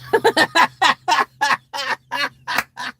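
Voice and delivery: high, loud drawn-out cackle; obnoxious laugh. Acted. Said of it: "she laughed it obnoxiously".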